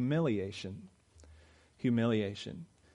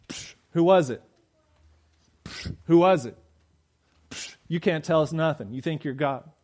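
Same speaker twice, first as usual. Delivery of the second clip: mocking taunt; 3 semitones higher, 3 dB louder than usual. Guards jeering at the blindfolded prisoner, mocking loud. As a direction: sneer and taunt him.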